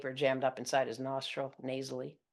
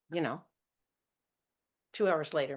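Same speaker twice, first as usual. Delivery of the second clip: ordinary delivery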